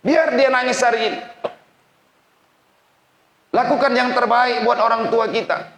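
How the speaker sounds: high, loud fervent sermon voice; a preacher pleading with young students; impassioned preaching. Acted. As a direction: preach it out with full emotional force. Preacher rises to full force.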